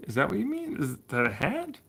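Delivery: ordinary delivery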